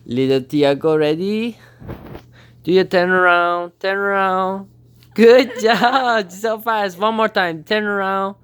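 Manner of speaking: high, bright coaxing; high cooing commands to Tiago; pet training talk. Real, not acted. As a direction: urge the bird to spin. Training the parrot to turn.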